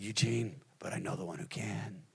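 low, hushed retold reassurance; gentle commending. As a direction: imitate a friend quietly entrusting someone to God. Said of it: "commending me".

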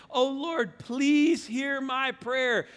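high, loud cry to the Lord; pleading prayer. Acted. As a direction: call upon God, stretching the plea. Reads Nehemiah's plea.

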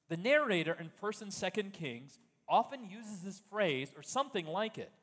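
ordinary talk, nothing marked

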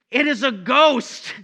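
loud, high, drawn-out cry of alarm; fearful cry. Acted. Disciples seeing a figure walking on the sea, crying out in fear. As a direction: cry it out slowly, as if terrified. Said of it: "they cried out in fear".

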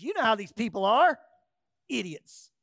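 loud, quick, put-on voice of Aaron making excuses; Aaron's excuse. Aaron brushing off Moses by blaming the people, mocking excuse voice. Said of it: "Aaron says".

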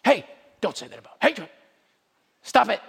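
mock offended person. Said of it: "without going".

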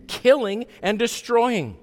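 normal talking voice

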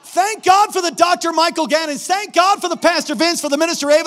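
high, loud exhortation from the pulpit; full-force preaching. Real, not acted. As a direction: preach it hard, urging the congregation.

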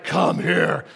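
deep, drawn-out giant's call; Goliath voice. Acted. Goliath calling out to David, deep mocking giant voice. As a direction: boom it like a towering giant. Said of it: "That's my Goliath voice".

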